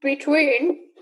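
said around a sip of coffee; words through a mouthful of coffee; while sipping a drink. Real, not acted. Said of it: "sorry, I'm drinking my coffee".

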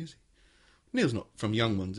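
usual speaking voice

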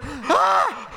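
a sudden yelp of disgust; retold scream. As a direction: friends scream as they wake up wet. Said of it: "And then I just hear".

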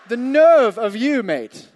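slow, loud mock outrage, half laughing; indignant retort. Pastor mocking the officer's scripture with loud disbelief. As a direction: throw it back at him, drawn out and incredulous. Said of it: "And I was like".

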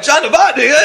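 high, loud happy greeting with a laugh; cops cheering. Retold or imitated. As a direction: call his name like fans. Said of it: "They're like".

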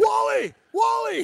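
very high yelled name; hammed-up high yell; mock screaming. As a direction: scream for the coach's attention. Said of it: "They got the guy screaming".

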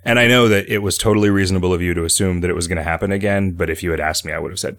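usual speaking voice

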